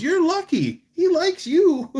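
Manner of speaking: imitating an excited boss, very high and loud; excited exclamation. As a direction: squeal it as a mock-amazed boss. Boss's excited reaction, retold.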